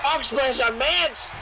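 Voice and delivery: slow, high, shouted play-by-play; wrestling commentator. Real, not acted. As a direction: call the big move like an announcer. Calling the match live.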